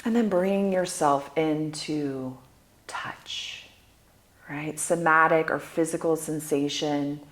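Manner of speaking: soft, unhurried meditation voice with long pauses; guided meditation. Acted. Guide leading listeners through sound, smell and touch, guided meditation. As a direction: guide them gently through each sense, letting phrases fade.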